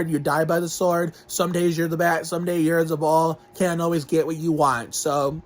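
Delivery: normal talking voice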